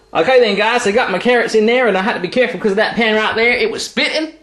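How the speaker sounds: high, hammed-up American accent; English speaker badly faking an American accent. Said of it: "trying to do an American accent really badly".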